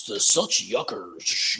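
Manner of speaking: slow, low, whispery announcer imitation; mock sleepy announcer. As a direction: drag it out like a dull announcer. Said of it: "the Padres announcers are like".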